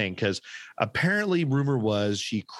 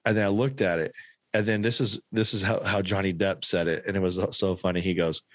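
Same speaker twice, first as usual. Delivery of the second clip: usual speaking voice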